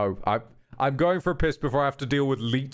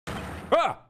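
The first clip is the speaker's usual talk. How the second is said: sudden high yelp of fright; startled yelp; 13 semitones higher than usual. Real, not acted. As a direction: jump at the scare. Jump scare while playing.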